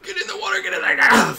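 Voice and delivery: high panicked shouting; frantic yell. Real, not acted. Frantic yelling at partner.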